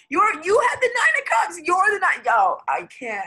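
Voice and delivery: high, loud overjoyed shout; can't contain the thrill of the card; excited shout. Real, not acted. Reading the Nine of Cups, shouting with excitement.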